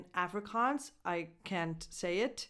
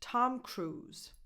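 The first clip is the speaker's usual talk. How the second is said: normal talking voice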